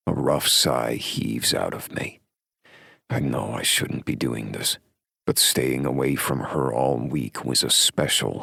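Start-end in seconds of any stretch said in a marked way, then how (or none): none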